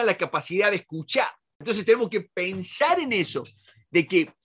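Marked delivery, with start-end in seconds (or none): none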